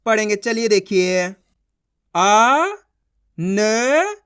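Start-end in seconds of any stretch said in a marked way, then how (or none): 2.2-4.2 आ and न each held long, separately; letter-by-letter sounding out. Shown as a demonstration. Teacher showing beginners how to read letters, sounding out. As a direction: draw out आ, then न, rising at the ends. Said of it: "अब देखो किस तरीके से अपन इन शब्दों को".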